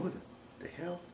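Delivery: acted hushed, breath-only disbelief; very soft, halting startled whisper; frozen with fear. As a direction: whisper it as if too scared to move. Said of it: "frozen with fear".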